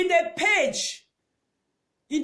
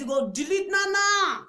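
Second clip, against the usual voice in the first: ordinary delivery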